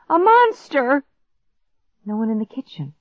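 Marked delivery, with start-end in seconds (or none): 0.1-1.0 high, loud cry of alarm; yelling. A narrator's reading. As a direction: yell a warning to the house. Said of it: "I yelled".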